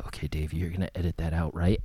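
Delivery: low, quick, soft aside; quiet and casual, pitched well down. Retold or imitated. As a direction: imitate a confidential request, voice dropped. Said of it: "he's like".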